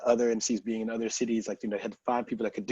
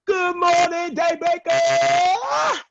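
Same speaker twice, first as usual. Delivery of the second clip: much higher, loud, slow stretched announcer yell; MC hype call; 11 dB louder, much slower than usual. Retold or imitated. MC greets the crowd, hype yell. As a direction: belt it to the whole dance floor. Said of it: "I just go".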